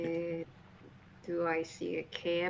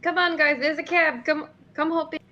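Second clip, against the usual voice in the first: high, loud call to companions; 9 semitones higher, 11 dB louder than usual. Acted. Player calling friends into the cab, high loud call. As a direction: wave the group over with a shout.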